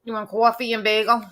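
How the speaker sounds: New York accent. Shown as a demonstration. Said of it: "now you're not saying it like".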